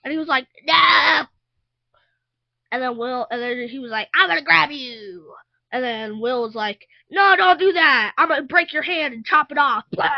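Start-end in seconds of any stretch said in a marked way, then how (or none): 0.6-1.2 loud, raised cry of refusal; mock defiant yell. Child retelling the fight as the villain refusing Eleven, loud mock yell. 4.1-5.3 louder, raised play-acted menace; villain threat. Child playing the villain going after Will, loud higher mock threat. 7.1-10.1 loud, raised threat; mock fighting yell. Child playing Will fighting the villain, loud higher mock yell.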